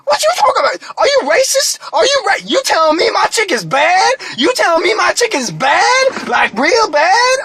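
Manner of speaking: high-pitched, indignant mock outrage; hammed-up affronted cashier, voice shooting upward; offended cashier. Cashier accuses customer of insulting his chicken, acted indignation.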